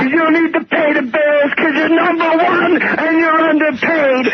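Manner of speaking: mock rant.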